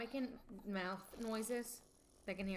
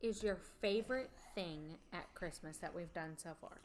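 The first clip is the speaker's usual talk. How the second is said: ordinary delivery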